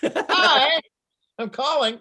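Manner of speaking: high, loud laughing whale call. Pretend. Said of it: "do your best whale call for me".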